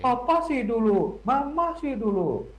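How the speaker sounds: high, drawn-out mock whine; mock blaming. Child blaming each parent for the decision, mock blaming. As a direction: imitate a child passing the blame, each phrase sliding down.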